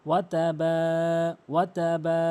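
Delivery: "ওয়া তাবা" with the baa stretched one beat; lengthened vowel. Shown as a demonstration. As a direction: draw out the end of each "ওয়া তাবা". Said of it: "এক আলিফ টানবেন".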